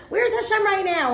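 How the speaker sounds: high, mock-plaintive demand; challenging doubters. Doubter complaining about everyday troubles, high and mock plaintive. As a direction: wail the question a little, as a doubter would. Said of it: "and we're like".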